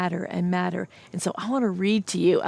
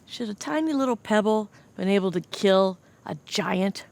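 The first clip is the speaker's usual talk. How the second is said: ordinary delivery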